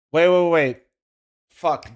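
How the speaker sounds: normal talking voice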